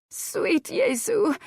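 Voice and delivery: high, slow theatrical lament; dramatic swoon. A narrator's reading. Countess clutching her heart in false horror, slow and high. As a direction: draw it out like a fainting lady.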